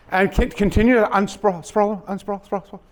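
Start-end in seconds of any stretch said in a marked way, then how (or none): none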